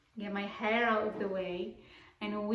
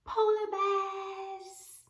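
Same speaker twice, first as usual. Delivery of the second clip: sharp gasp, then high and very slow; thrilled storytelling reveal; gasp and excited reveal; 10 semitones higher, much slower than usual. Acted. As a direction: stretch the words out in wonder. Teacher's big reveal to children.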